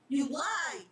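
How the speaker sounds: put-on boy shouting at the reaper; angry accusation. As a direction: throw it back at him.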